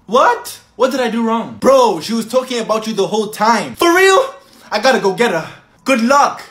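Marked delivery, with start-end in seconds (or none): 3.8-4.3 excited surprise. Acted.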